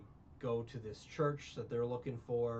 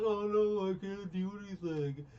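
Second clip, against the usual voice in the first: higher, louder hammed-up wail of helplessness; a put-on cry of defeat; mock despair; 6 semitones higher, 4 dB louder than usual. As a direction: play the fallen heroine, overdone. Retelling the heroine's fall.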